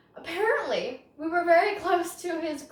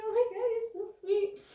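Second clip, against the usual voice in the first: gushing thanks; 9 semitones higher, 3 dB quieter than usual. Retold or imitated. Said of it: "And I was like".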